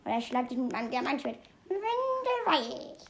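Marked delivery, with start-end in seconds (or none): 1.7-3.0 drawn-out high mock threat. Gamer teasing the fox, slow high mock threat. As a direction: stretch the word out high and slow to tease.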